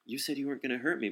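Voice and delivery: quick, mildly indignant complaint, quoting someone. Retold or imitated.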